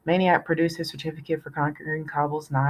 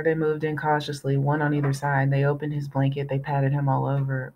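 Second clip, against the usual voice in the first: plain reading voice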